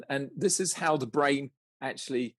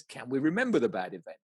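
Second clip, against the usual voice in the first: normal talking voice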